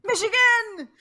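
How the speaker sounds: high, loud drawn-out cheer; gleeful call-out; exuberant shout. Real, not acted. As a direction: belt out the answer in triumph. Calling out the obvious answer.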